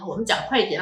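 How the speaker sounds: usual speaking voice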